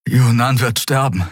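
höher, außer Atem; keuchend. A narrator's reading. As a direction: keuche die Worte erschöpft hervor. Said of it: "keuchte Kai". (atemlos gekeucht)

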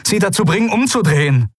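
put-on pressing young man's voice; urgent Kai. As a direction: push the request quickly. Kai urging someone.

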